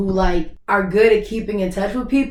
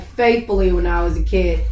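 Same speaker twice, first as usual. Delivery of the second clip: ordinary talk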